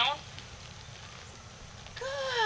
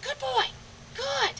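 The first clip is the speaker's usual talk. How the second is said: dog praise, pitched way up; sing-song praise to the dog; high praise voice; 13 semitones higher, 6 dB quieter, slower than usual. Real, not acted.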